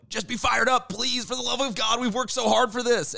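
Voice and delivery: high, strained pleading yell; mock screaming. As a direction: imitate players desperately screaming a pep talk.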